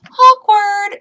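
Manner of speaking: high, loud sing-song awkward; mocking friend in the theatre. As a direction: blurt it out teasingly. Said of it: "he goes".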